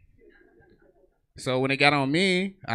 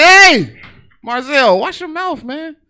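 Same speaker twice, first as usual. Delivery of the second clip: much higher, loud mock scold of a student; high scolding teacher; 16 dB louder, slower than usual. Teacher scolding a student named Marzell, high and loud. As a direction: squeak out a scolding like a flustered teacher.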